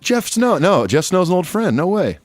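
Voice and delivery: high-pitched, delighted disbelief; real happy surprise. Real, not acted. Podcast host learns an old friend wrote in, real surprise. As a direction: exclaim high at an unexpected familiar name.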